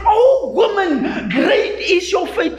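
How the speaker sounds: Jesus's excited shout over the woman's faith; excited cry. Acted. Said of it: "Jesus screamed".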